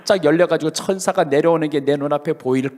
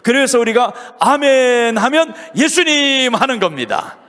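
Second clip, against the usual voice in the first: louder, higher, driving pulpit voice; fervent preaching; 6 semitones higher, 3 dB louder than usual. Acted. Pastor urging the congregation to say amen, fervent preaching. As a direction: preach it out with mounting fervor.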